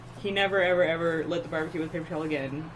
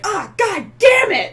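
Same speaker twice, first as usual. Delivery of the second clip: loud pained curse, drawn out; angry yell; 12 semitones higher, 12 dB louder, slower than usual. Retold or imitated. Roommate cursing loudly from the barbecue. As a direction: yell out in burnt frustration. Roommate yelling after fireball.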